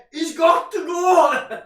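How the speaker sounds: high, slow mock shout; Claude from Arsenal Fan TV. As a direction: imitate an arguing fan yelling for a sacking. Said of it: "And Jace would be there".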